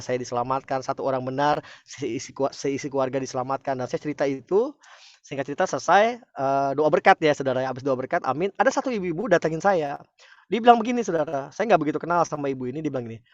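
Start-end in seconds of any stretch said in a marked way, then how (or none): none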